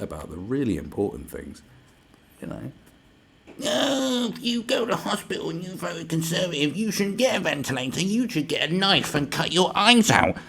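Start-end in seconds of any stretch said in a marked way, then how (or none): 3.6-10.4 high, whiny put-on scold; shrieky mock outrage; mocked online hater. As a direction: caricature a sanctimonious commenter, much higher. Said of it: "That's what they're talking like".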